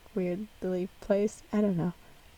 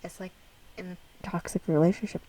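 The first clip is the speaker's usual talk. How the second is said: usual speaking voice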